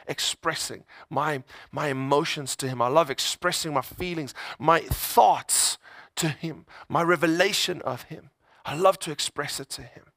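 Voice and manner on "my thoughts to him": normal talking voice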